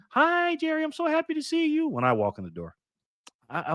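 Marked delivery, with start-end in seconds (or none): 0.1-1.9 high-pitched mock female voice; happy woman's greeting. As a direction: play a woman delighted to see him, pitched way up. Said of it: "If only I had a woman tell me".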